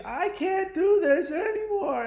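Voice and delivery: very high, drawn-out imitation of tearful wailing; loud mock meltdown, stretched and squealed; mock hysterical wail. Ex breaking down before running back inside, imitated hysterical wail.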